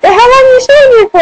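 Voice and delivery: imitating customers in a very high voice; high-pitched mock questioning; curious customers. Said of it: "I had people asking me".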